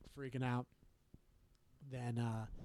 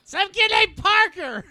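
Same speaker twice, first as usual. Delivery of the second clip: higher, louder, slow call across house; mom calling back; 12 dB louder, slower than usual. Retold or imitated. Mom telling daughter who is calling, calling out. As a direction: shout the answer back.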